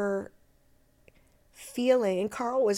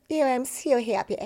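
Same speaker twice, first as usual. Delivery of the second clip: higher, put-on royal English accent; Kensingtonian British accent; 4 semitones higher than usual. Shown as a demonstration. Actress demonstrating the Queen's neighbourhood speech, Kensingtonian accent. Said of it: "Just the Queen and just".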